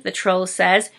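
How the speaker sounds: ordinary delivery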